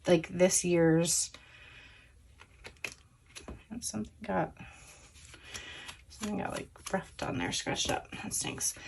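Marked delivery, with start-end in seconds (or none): 3.8-8.8 near-whispered aside to herself; dismayed muttering under her breath; quiet aside. Real, not acted. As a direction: drop to a breathy murmur over the scratch. Notices scratch, aside to self.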